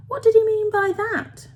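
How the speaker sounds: put-on very high, girlish question; puzzled girl. A narrator's reading. Girl questioning the old man's odd remark, high and puzzled. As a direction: wonder aloud with pitch lifted right up.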